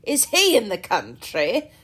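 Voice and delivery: loud, booming, jovial squire; loud hearty country gentleman. A narrator's reading. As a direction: boom it out like a cheerful host. Sir John voiced by reader.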